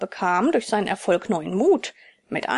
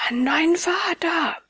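shocked echo; 7 semitones higher than usual. Acted. Puppet echoes threat in shock.

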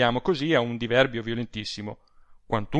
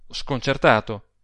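usual speaking voice

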